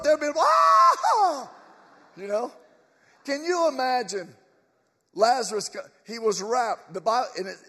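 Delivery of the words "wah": loud, high "wah" shout; mock crowd roar. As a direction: burst out like a whole graveyard waking. Imagines whole cemetery rising.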